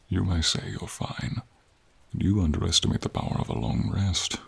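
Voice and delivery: ordinary talk, nothing marked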